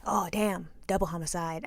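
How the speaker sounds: low, soft deadpan aside; muttered aside. Retold or imitated. Girl in the background commenting on the confession, muttered aside. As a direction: drop it under your breath, imitating a bystander.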